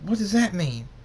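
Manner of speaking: high, loud worried question; alarmed. Acted. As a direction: panic at the diagnosis. Both alarmed by diagnosis.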